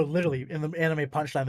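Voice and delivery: ordinary delivery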